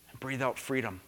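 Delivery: soft, low exhale on freedom; breathed out softly. Shown as a demonstration. Pastor leading the congregation in a breath, softly exhaled. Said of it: "take a deep breath, hold it in".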